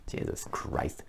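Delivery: breathed out under the breath, no voice; a real whispered groan of disbelief; whispered Jesus Christ in sheer exasperation; exasperated whisper. Real, not acted. Exasperated at the news report.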